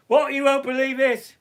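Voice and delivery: high, loud Irish accent slipping into British; botched Irish accent. Shown as a demonstration. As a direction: attempt an Irish accent and miss. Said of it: "I could do an Irish accent".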